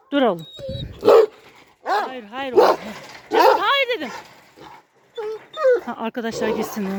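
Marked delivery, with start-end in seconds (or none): 0.1-4.1 loud commanding yell, rising higher; real shout. Real, not acted. Owner shouting at her dog lunging at another dog, loud yell. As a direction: yell no at the dog with full force.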